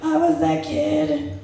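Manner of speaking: high, slow stunned gasp of recognition; shocked realization. Retold or imitated. Comic realising she was the kid in the movie, slow and stunned. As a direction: let it dawn on you in horror.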